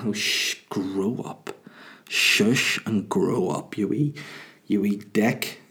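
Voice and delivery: soft, slow, mock-stern shushing; hushing. Dad telling his baby to grow up, hushed mock scolding. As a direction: hush her like a teasing parent.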